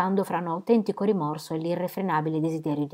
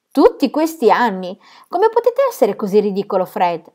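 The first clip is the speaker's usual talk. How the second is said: louder, higher, with an edge of sharpness; sharp exclamation; 3 semitones higher, 7 dB louder than usual. A narrator's reading. Lucy snapping back at Fred's reproach, sharp and loud. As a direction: brush him off tartly. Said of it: "esclamò Lucy con una certa asprezza".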